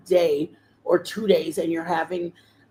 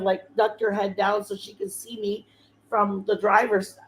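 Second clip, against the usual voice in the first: ordinary talk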